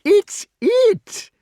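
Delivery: very high, loud squeal; high delight. A narrator's reading. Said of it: "he shouted in high delight".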